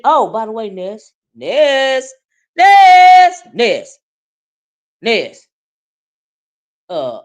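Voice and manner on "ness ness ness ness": shouted name, much higher and louder; calling out. Real, not acted. Calling out to a viewer by name, loud shout. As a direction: yell her name to get her attention.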